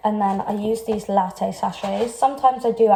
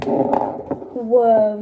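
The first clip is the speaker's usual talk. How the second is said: surprised. Real, not acted. Egg nearly flies off.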